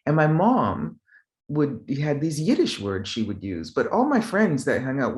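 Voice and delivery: ordinary talk, nothing marked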